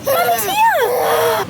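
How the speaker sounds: very high, drawn-out coo. Real, not acted. Mum tells her crying baby she's there, high cooing.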